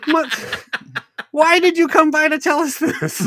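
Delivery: high, loud mock disbelief; incredulous outburst. Driver to witness offering useless report, hammed-up incredulity. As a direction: squawk it in hammed-up exasperation.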